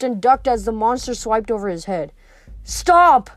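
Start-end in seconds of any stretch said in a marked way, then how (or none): 2.6-3.3 high, loud cry; panicked plea. A narrator's reading. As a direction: cry out begging for mercy. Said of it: "Grover pleaded".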